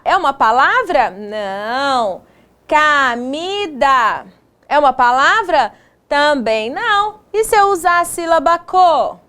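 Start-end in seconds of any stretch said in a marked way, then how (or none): 2.7-4.3 "Camida" very slow, each syllable stretched; sounding out. Shown as a demonstration. Teacher testing made-up word.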